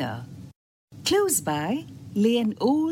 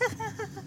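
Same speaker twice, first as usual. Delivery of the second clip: high, soft little chuckle; self-satisfied giggle; 13 semitones higher, 11 dB quieter than usual. A narrator's reading. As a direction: giggle smugly in character. Boastful oyster finishes bragging.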